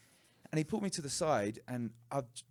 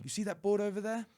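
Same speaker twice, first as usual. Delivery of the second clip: higher, louder, pushy demand; harsher repeat; 9 semitones higher, 6 dB louder than usual. Retold or imitated. Owner ordering the boy to the board: louder and higher. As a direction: bark it at the boy.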